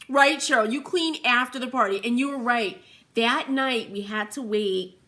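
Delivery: normal talking voice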